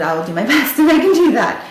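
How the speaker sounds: quick, brighter, a laugh breaking into the line; laughing through it. Retold or imitated. Promising the doctor she'll keep the secret, laughing through it.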